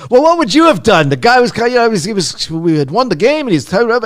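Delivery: loud, high-pitched retort, imitated; angry. Coach defending himself to the interviewer, angrily shouting. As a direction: yell back like an outraged coach defending himself. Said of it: "he got so angry".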